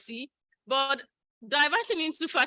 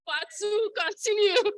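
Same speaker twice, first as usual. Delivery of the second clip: high excited; 9 semitones higher, 5 dB louder, slower than usual. Real, not acted. Joking emotional sequel.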